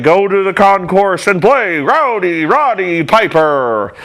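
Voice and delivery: slow announcer drawl, stretched out; mocking the PA guy's announcement. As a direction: sell it over the loudspeaker. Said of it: "And he's like".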